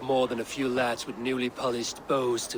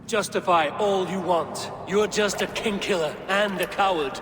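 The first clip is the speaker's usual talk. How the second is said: loud, higher, contemptuous shout; angry accusation; 8 semitones higher, 6 dB louder than usual. Acted. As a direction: spit the accusation at him with scorn. Accusing a king killer.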